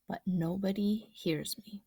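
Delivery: usual speaking voice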